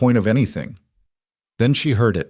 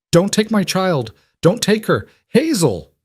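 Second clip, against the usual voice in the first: high loud screaming plea; anguished scream; 11 semitones higher, 4 dB louder than usual. A narrator's reading. Mother screaming at the men taking her child. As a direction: scream it after them.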